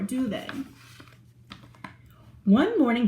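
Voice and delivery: ordinary delivery, nothing marked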